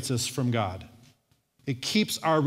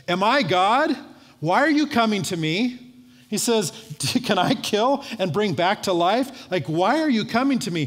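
high, raised-voice protest of an alarmed king; indignant king; 5 semitones higher than usual. Retold or imitated. King tears his robe at Naaman's letter, indignant. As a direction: act out a king's exasperated questions.

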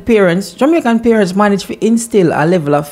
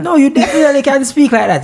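lightly put-on British accent, a touch higher; mock British accent; 10 semitones higher, faster than usual. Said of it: "give me your British accent".

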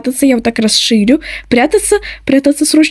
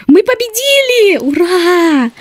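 excited; 5 semitones higher, slower than usual. Real, not acted. Winning the round.

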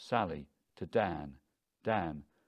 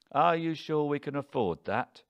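raised, louder incredulous query; higher, pressing doubt; doubting question; 6 semitones higher, 5 dB louder than usual. A narrator's reading. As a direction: question your partner's plan, pitched up. Doubting husband questions his wife.

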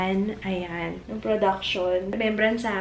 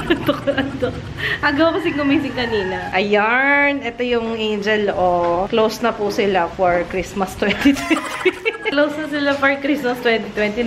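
normal talking voice

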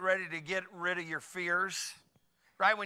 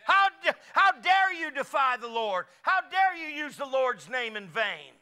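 slow indignant challenge, louder and higher; outraged David; 7 semitones higher, 6 dB louder, slower than usual. Retold or imitated. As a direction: voice David's fury at the blasphemer. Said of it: "He goes".

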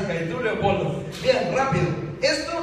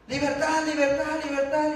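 higher, insistent parrot cry of "Libertad"; parrot squawk; 6 semitones higher than usual. Retold or imitated. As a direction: squawk it over and over from the cage. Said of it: "y siguió gritando".

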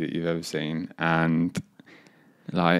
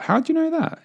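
caught-out surprise; 4 dB louder than usual. Retold or imitated. Caught out by the teacher.

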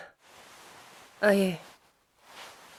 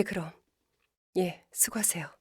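unvoiced, softer sign-off; whispered goodbye; 4 semitones lower, 9 dB quieter than usual. Team lead ending an annoying call, whispered. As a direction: whisper it as you hang up.

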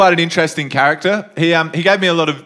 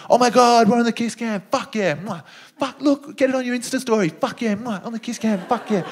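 higher, breathless squealing couple voice; hammed-up excited young couple; 9 semitones higher than usual. Young couple gushing on the big screen, hammed-up excited. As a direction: gush like thrilled young lovers on camera.

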